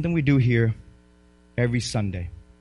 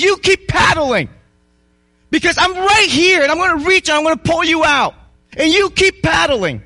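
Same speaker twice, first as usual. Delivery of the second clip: higher, louder encouraging call; God urging on; 11 semitones higher, 6 dB louder than usual. Acted. God speaking to the struggling believer, loud encouraging call. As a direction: promise rescue with conviction.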